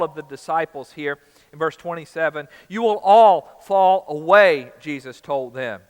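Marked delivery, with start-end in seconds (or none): 2.7-4.7 loud, slow, drawn-out prophecy; booming slow pronouncement of coming failure; solemn warning. Acted. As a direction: proclaim it slowly and heavily, like a verdict. Jesus warning disciples, scripture quote.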